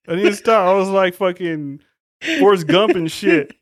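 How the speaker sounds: high, loud comic explaining; mock frantic shout. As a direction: blurt it out like a rattled runaway. Acted frantic explanation of running away.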